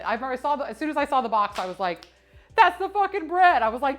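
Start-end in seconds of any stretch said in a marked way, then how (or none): 2.6-3.6 loud, high burst of recognition; excited outburst. Retold or imitated. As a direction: blurt it out, thrilled to finally find it.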